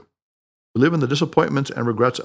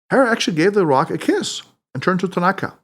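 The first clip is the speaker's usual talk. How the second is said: ordinary delivery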